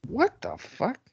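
stunned, high-pitched disbelief; shocked. Real, not acted. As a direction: blurt it out in shock. Reacting to shocking pin.

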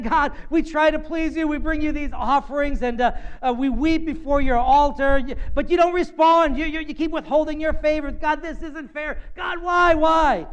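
high, building plaintive complaint to God; mock tearful pleading. Israel complaining to God across the table, hammed-up pleading. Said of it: "they're weeping and crying, and they're saying".

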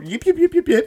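high quick yips; playful yip noises. Retold or imitated. Telling of decking his little brother, high playful yips.

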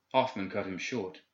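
ordinary delivery, nothing marked

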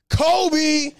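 high mock Kobe call as he shoots; Kobe shout. Comic reenacting tossing a napkin, high mock Kobe call. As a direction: toss the name out high like a jump shot. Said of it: "and I was like".

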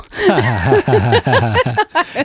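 loud laugh bursting out after the punchline; burst of laughter. Real, not acted. Coworker telling a friend her story, laughing hard. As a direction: crack up at your own story.

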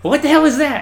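high, loud outraged chief; mock chief yell. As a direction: shout it in disbelief. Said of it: "he's like".

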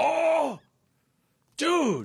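high, gleeful oh dude; delighted. Real, not acted. Friend hears the book title pun, high delighted. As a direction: savor the pun with glee.

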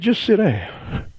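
slow, soft, calm sit down; quiet, slowed-down God voice; calm, slow command. Acted. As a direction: let God settle them gently. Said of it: "God said".

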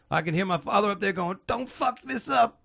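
high, strained mock stage delivery; imitating a comedian. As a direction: mimic him, stretching out the last words. Imitates comedian's opening line.

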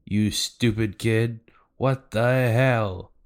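hammed-up parental outburst; angry scolding. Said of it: "starts scalding Nami".

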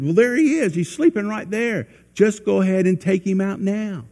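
high, eager push from the men; urging soldiers. Retold or imitated. David's men urging him to kill the sleeping Saul, high and eager. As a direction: egg him on to strike now, pitched high.

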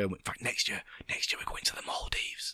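whispered like letting you in on a secret; secretive whisper. Real, not acted. Teasing a secret future trip.

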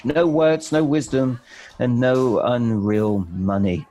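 reading the poem's twist with mounting dismay; dramatic poem reading. Acted. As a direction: build puzzled disbelief at the blank card. Poem narration, climax.

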